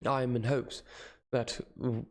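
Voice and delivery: usual speaking voice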